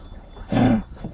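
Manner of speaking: sharp, high acted gasp; audible startled gasp. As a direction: suck in a breath in amazement.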